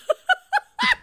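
high-pitched burst of laughter. Real, not acted. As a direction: laugh out loud in delight. Laughing at honk joke.

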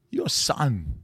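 impression demo with a break between words; lightly voiced, halting two-word line; Walken. Shown as a demonstration. Coach showing a line to copy, Walken impression. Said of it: "Try walking".

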